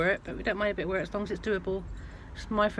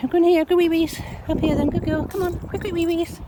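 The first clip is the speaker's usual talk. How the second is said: high, loud, eager dog talk; urging the dog; 10 semitones higher, 7 dB louder than usual. Real, not acted. Owner hurrying her dog to go wee, loud high urging. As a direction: hurry her up, bright and much higher and louder.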